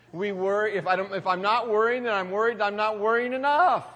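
rising to a loud preaching peak; preaching build. Acted. Pastor to congregation, building to a loud, high peak.

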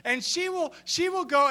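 ordinary delivery

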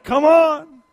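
hammed-up hurry-up call; mock urging call. Friends urging him up the mountain, mock loud call. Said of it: "They're like".

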